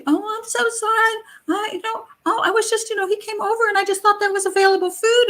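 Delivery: very high mock girlish apology; sweetly apologetic. Tenant excusing taken food to her landlady, sweet high mock voice. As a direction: imitate an innocent, childlike excuse. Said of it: "she was still really sweet".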